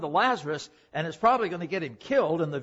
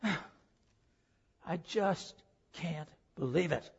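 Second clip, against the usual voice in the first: very slow, much softer, sighing out the words; weary disbelief; 7 dB quieter, much slower than usual. Retold or imitated. Thomas answering news of the resurrection, slow soft sighing disbelief. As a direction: sink under grief you can't get past. Thomas's doubt after the death.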